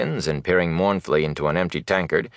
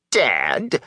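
higher, indignant question; snapped indignantly; 5 semitones higher than usual. A narrator's reading. Peddler affronted at being thought dead, indignant. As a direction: throw the word back, affronted. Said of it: "the peddler snapped indignantly".

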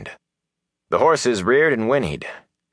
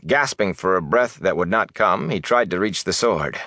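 plain reading voice

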